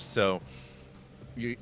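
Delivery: ordinary delivery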